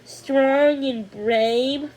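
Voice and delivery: dreamily, slowly savoring; dreamy. Acted. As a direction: drift off in admiration. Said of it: "Thumbelina continued dreamily".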